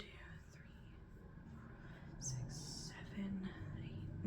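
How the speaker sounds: counting under the breath in a whisper; soft unvoiced tally while thinking. Real, not acted.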